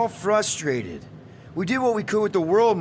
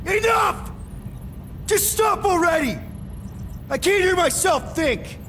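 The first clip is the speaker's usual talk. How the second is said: high-pitched shout to silence everyone; exasperated yelling; 12 semitones higher, slower than usual. Acted. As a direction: cut through the squabbling, at breaking point. Leader breaks up crew's argument.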